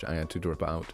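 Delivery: ordinary talk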